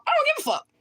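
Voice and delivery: sudden high defiant yelp; defiant outburst. Real, not acted. Streamer to trolls in her comments, defiant high yelp.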